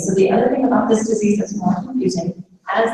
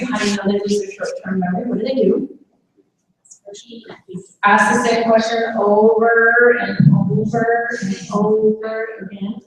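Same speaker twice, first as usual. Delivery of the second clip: ordinary delivery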